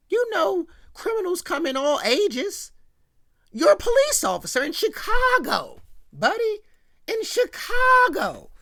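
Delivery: high, emphatic disbelief; exasperated scolding. Acted. Recapper chides Carl the policeman for trusting a stranger, exasperated scolding. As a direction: hammer it home, loud on the city.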